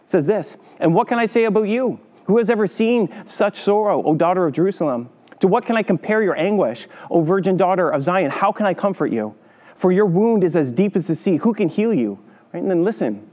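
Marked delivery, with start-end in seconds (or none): 0.8-12.2 high, lamenting reading of the verse; scripture reading. Acted. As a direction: plead over the wounded city.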